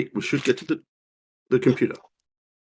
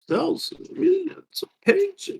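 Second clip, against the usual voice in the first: mock despair; 4 dB quieter than usual. Real, not acted. Player exaggerates frustration.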